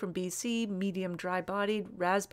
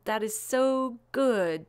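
usual speaking voice

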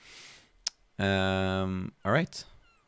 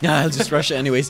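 louder, higher, quick dismissive line; brash player quote; 5 semitones higher, 9 dB louder, faster than usual. Acted. Commentator voicing the player who rushes anyway, brash. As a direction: toss it off fast and loud. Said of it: "He's just like".

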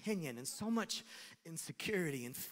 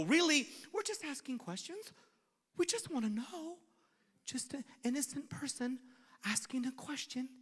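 mock innocent questioning; 8 semitones higher than usual. Feigning innocence toward God.

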